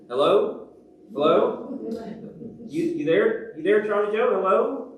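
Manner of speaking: high, loud, rising questions; calling into silent phone. Acted. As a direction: check if the line has gone dead. Caller getting no answer on phone.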